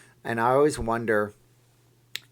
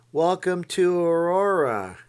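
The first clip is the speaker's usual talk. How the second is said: high, slow put-on Garth voice, a touch louder; Garth impression; 8 semitones higher, 5 dB louder, slower than usual. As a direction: play a nervous Garth Algar greeting the crowd. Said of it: "do your really great Wayne's World Garth impression".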